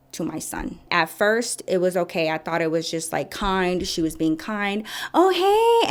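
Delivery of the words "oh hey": high, loud put-on greeting; cooing receptionist. Receptionist greeting the son, gushing. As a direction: mimic a gushing high hello. Mimicking woman overly kind to son.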